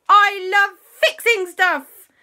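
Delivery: high, slow and loud eager voice; gleeful little fixer, high and slow; JB's voice. Acted. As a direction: sing it out, big and eager. JB says he loves fixing.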